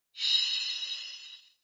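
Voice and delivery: hissed unvoiced shush; sharp shush. A narrator's reading. Dobby silences Harry, sharp unvoiced shush. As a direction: hush him at once. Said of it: "Dobby shushed him".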